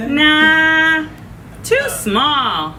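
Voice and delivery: doctor. Acted. Doctor rejects needle.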